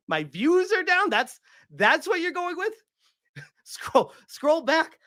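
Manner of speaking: can't believe what he's hearing; incredulous disbelief. Real, not acted. Streamer to chat, repeating a viewer's jab about his views, in rising disbelief.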